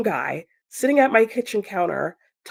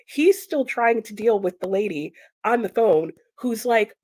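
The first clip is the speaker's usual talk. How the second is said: usual speaking voice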